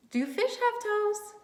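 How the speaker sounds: put-on teacher teasing kids with a question; mock astonished question. Shown as a demonstration. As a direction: ask it like it's absurd. Asking kids a silly question.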